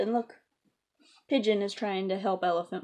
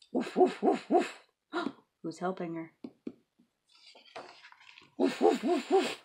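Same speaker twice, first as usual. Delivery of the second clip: light, higher woofs; barking dog; 3 semitones higher than usual. Acted. A puppy arriving to help Piggy, light woofs. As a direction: bark like a friendly pup.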